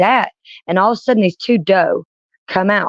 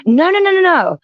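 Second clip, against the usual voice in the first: sharp high run of no's, much higher; emphatic no; 12 semitones higher than usual. Real, not acted. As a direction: protest fast and high.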